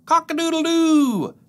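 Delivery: high, loud rooster crow; hammed-up cock-a-doodle-doo. Imitating a rooster for kids.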